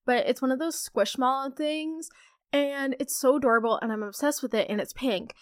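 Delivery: ordinary delivery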